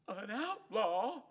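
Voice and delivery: high, loud, slow astonished echo; shocked gasp. Acted. Drover hearing Hank will become an outlaw, shocked. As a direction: gasp the word in disbelief. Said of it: "The breath whistled through his throat".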